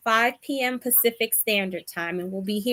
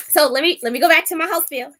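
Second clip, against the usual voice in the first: excited; 7 semitones higher, 7 dB louder, faster than usual. Real, not acted. Switches back to host mode.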